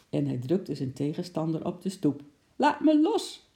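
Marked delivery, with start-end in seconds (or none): 2.6-3.5 high, loud cry to be released; yell. Acted. Policeman pinned to the ground yells, high and loud. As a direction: shout it in protest. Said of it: "schreeuwde de agent".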